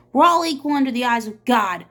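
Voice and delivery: loud, firm declaration; declaring. Acted. Miss Catherine declaring equality to the sheriff, loud. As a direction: stand your ground proudly. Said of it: "she declared".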